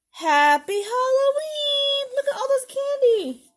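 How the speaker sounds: high, loud, stretched celebration; excited cheer. Acted. Storyteller reveals all the surprise candy, jubilant cheer. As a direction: cheer the big reveal to the children.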